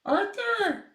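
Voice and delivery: high-pitched holler of a pet's name; calling a cat. Real, not acted. Owner calling kitten Arthur again, high shout. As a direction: call out loud and very high.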